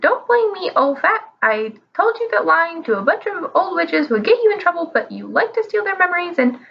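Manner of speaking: high mocking bird voice; cheeky parrot voice. A narrator's reading. Parrot argues with wizard.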